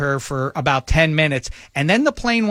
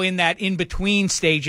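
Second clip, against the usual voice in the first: normal talking voice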